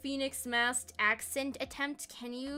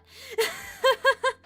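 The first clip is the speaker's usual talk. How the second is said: high short laugh; high giggle; 12 semitones higher, 7 dB louder than usual. Real, not acted. Laughs at accent question.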